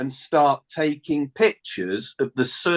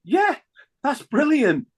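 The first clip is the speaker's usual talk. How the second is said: much higher, thrilled burst of joy; excited yelp; 13 semitones higher than usual. Retold or imitated. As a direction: squeal it out like you can't believe it. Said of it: "And I was like".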